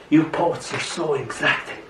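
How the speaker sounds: soft, weary grumble; moaning. A narrator's reading. Memory complains to the poet, moaning. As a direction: complain softly, as if put upon. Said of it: "Memory moans".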